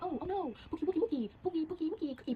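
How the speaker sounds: put-on high-pitched lovey-dovey baby talk; mock cooing. Girl comforting her boyfriend, mock cooing. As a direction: coo pet names in a mocking squeal.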